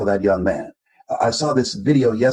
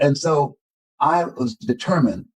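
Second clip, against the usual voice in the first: ordinary delivery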